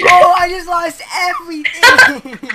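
slow, loud anguished wail; anguished yelling. Real, not acted. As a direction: wail over the loss. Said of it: "Why are you yelling".

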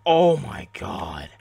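slow, loud, drawn-out groan of words; loud exasperation. Real, not acted. Gamer after missing a kill, loud exasperation. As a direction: stretch it out loudly in frustration.

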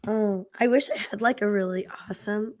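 usual speaking voice

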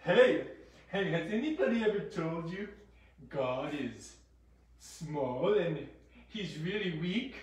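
soft, coaxing devil voice; lightly voiced devil. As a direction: plant doubts as a pretend tempter. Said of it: "the devil tried to tell them".

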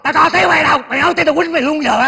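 loud, high-pitched indignant retort; angry threat. Acted. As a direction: raise your voice and threaten him. Threatens friend while denying shouting. (lớn tiếng hăm dọa)

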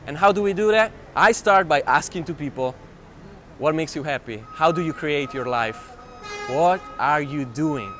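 usual speaking voice

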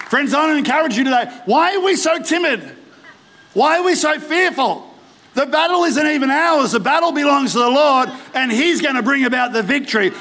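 rally them to rise up, full of conviction; impassioned revival preacher; fired-up preaching. Acted. Exhorts the church against fear.

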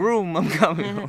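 high, slow, hammed-up sing-song; playful mock reply. Mimicking his partner's doodled car reply, slow high playful voice. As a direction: tease like a cartoon driver zooming over.

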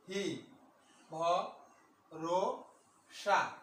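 every syllable of একা বসে আছি নিভৃতে said apart with pauses. Shown as a demonstration. Teacher dividing the poem line into syllables for students. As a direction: spell the line out one syllable at a time. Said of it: "teacher splitting line into syllables".

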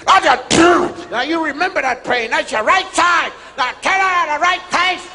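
drill instructor. Retold or imitated. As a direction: bark orders at the recruits like a drill sergeant. Drill instructor barking orders.

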